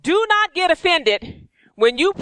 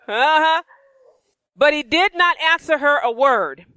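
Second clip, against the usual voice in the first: ordinary talk, nothing marked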